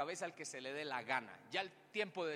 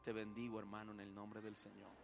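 mild pious blessing; 7 semitones lower, 9 dB quieter than usual. Pretend. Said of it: "¿Usted qué diría?".

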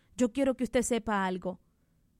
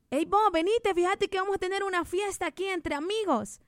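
tempting friend; 7 semitones higher than usual. Retold or imitated.

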